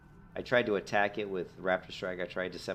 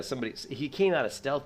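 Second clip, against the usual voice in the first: ordinary talk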